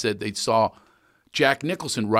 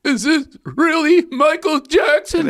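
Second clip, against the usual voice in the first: caller voice; 4 dB louder, slower than usual. Retold or imitated. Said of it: "they were calling in".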